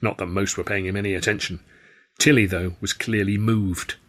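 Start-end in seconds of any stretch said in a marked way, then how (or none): none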